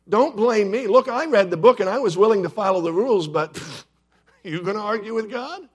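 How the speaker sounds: loud, quick-talking self-defence; Peter defending himself. Retold or imitated. Peter shifting blame to God, loudly defensive. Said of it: "Peter defends himself".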